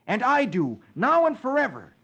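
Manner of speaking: high, loud ringing vow; ringing patriotic declaration. Acted. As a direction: proclaim it like an oath to the room. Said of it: "in a ringing voice".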